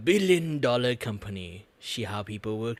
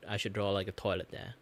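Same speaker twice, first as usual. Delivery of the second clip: usual speaking voice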